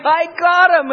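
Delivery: high, loud gloating crow of triumph; gloating Satan. Retold or imitated. Satan boasting over Jesus on the cross, high gloating voice. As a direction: crow like a villain sure he's won. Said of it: "Satan's going".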